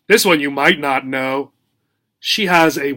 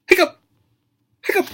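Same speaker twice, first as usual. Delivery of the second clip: hiccup; much faster than usual. Pretend. Acts two hippo hiccups.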